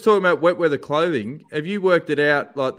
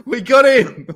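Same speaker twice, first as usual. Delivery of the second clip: loud, slow, triumphant; excited cheer; 7 semitones higher, 7 dB louder, slower than usual. Real, not acted. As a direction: celebrate the catch. Guest joins the podcast call.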